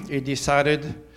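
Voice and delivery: normal talking voice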